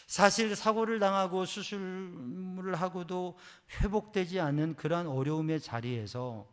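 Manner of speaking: ordinary talk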